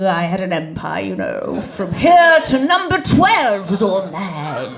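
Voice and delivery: slow, grand boast of a cat with a lost empire; lightly voiced pompous cat; haughty Persian cat. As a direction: lord it over the street, drawn out. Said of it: "Persian cat walking around going".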